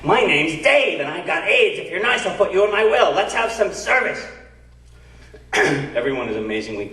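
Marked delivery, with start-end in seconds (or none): 0.0-4.2 Dave's voice. Retold or imitated. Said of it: "he repeats what he said".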